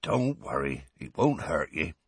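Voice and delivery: low, soft and patient; calm her gently. A narrator's reading. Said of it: "said Hagrid patiently".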